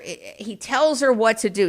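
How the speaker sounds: ordinary delivery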